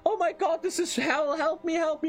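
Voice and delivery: very high-pitched mock begging for rescue; panicked plea. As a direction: plead frantically in a squeaky put-on voice. Acts out character's plea for rescue.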